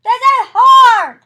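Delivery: frustrated yell, high and slow; loud sharp voice. Real, not acted. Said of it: "Why are you yelling?".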